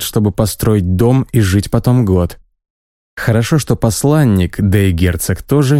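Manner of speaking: plain reading voice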